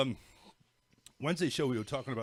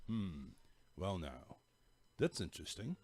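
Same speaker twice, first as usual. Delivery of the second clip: softer, lower boring host drone; monotone voice; 4 semitones lower, 8 dB quieter than usual. Retold or imitated. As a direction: drone like a dull talk show host. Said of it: "talks in a monotone voice".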